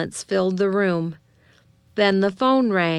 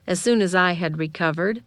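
ordinary delivery

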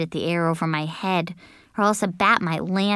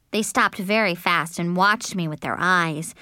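usual speaking voice

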